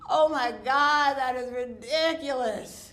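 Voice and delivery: mock her dream while still laughing; laughing scornfully. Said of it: "she's laughing at".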